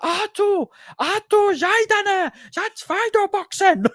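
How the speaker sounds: very high, strained German trainer yelling from the corner; imitating Uli Wegner in German. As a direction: bark it in German like a ringside coach. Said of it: "he's like Mickey from Rocky, but he said, like, a German version".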